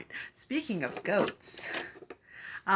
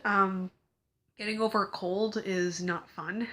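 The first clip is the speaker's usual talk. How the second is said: ordinary talk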